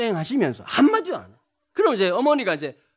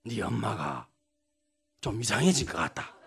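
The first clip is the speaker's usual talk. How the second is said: put-on much lower, softer, terse old father; lowered Gyeongsang father voice; gruff father; 11 semitones lower, 9 dB quieter than usual. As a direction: mumble it low like a shy old dad on the phone. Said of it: "그랬더니".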